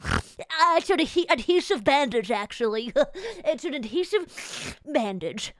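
lightly voiced know-it-all nerd. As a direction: say it like a smug nerd correcting. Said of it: "I sound like a fucking nerd".

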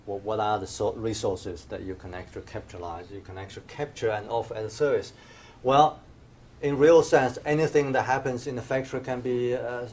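ordinary delivery

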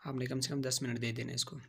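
ordinary talk

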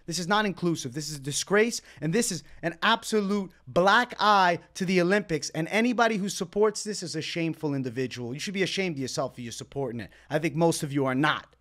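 normal talking voice

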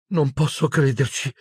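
high, very unsure murmur; uncertain Hermione. A narrator's reading. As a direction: murmur it in high-voiced disbelief. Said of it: "mormorò Hermione con voce molto incerta". (mormorio acuto e incerto)

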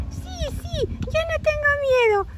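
very high, tiny defiant voice; little squirrel Ethan. Acted. Little squirrel insisting he is not afraid, very high squeak. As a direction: squeak it bravely like a small child. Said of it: "dice Ethan, el chiquitín".

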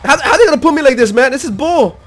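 loud, high-pitched gamer outrage; outraged yelling. Real, not acted. Player put in danger with no gun, outraged yelling. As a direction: yell in disbelief at the game. Attacked in game without a gun.

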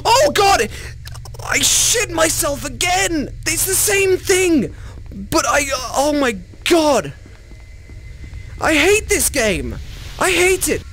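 high, frantic yelling; terrified yelling. Real, not acted. Gamer yells high in terror while being chased. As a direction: scream in pure horror-game panic.

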